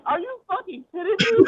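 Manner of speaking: high-pitched, incredulous outburst; acted disbelief. Victim facing a lineup of lookalike cops, incredulous. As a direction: replay your outrage, pitch jumping way up.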